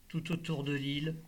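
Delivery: plain reading voice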